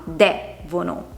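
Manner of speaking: devono split after a stressed DE, DÈ-vono; stress on third-last syllable. Shown as a demonstration. Teacher showing the accent on terzultima, DE stressed in devono. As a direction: lean on DE, then let vono drop. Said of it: "ha l'accento sulla terzultima".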